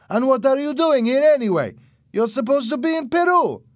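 high-pitched, loud accusation; shouted challenge. A narrator's reading. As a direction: press him hard at a yell. Armed man challenges Louis.

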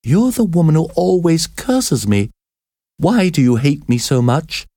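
put-on high, frightened girl's voice; trembling voice. A narrator's reading. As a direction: ask with a shaking fear. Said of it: "she asked in a trembling voice".

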